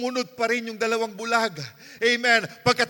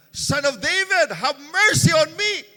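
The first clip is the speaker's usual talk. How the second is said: crying out; 6 semitones higher, 7 dB louder, slower than usual. Retold or imitated. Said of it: "sumisigaw din siya".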